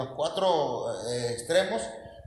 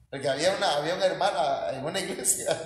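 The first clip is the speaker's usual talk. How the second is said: usual speaking voice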